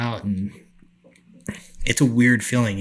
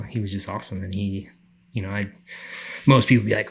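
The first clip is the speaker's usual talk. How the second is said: normal talking voice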